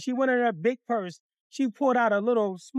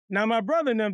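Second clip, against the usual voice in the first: ordinary talk, nothing marked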